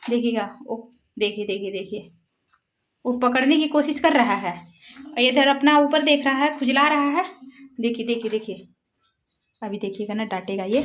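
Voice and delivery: hushed. Real, not acted. Hushed watching birds fight.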